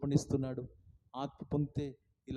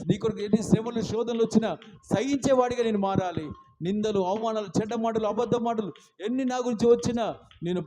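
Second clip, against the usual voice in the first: pleading prayer; 5 semitones higher, 10 dB louder than usual. Acted. Preacher praying for sight, pleading then hushed. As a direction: pray it down to a hushed plea.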